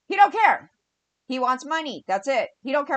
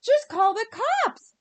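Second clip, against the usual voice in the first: much higher, slow, put-on voice for "Just call the cops"; mocking affluent women; 11 semitones higher, slower than usual. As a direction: parody a clueless, affluent woman's easy advice.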